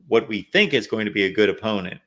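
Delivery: normal talking voice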